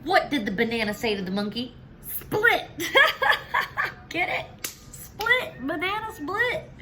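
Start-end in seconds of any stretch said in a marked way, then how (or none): 2.1-6.7 high, cackling punchline; laughing at own joke. Acted. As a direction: crack up at your own pun.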